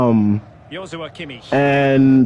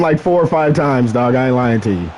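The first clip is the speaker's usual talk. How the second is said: ordinary delivery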